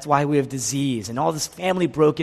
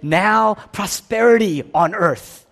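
loud, slow, raised announcement; mock proclamation; 4 semitones higher, 5 dB louder, slower than usual. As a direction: proclaim it grandly, stretching the words. Mimics a proclamation nobody makes.

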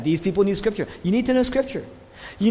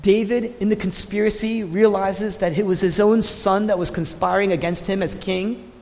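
ordinary talk

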